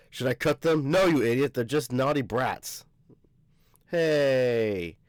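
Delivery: plain reading voice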